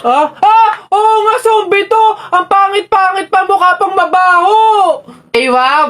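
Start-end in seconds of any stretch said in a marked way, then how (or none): 0.4-4.6 high, loud panicked yelling at a zombie; scared shouting. Acted. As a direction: shriek like you've seen a monster. Kids scream at supposed zombie. (takot na sigaw sa zombie)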